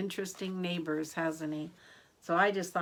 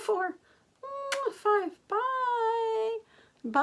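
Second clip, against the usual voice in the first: excited counting; 5 dB louder, much slower than usual. Pretend. Counting blown kisses from Lincoln puppet.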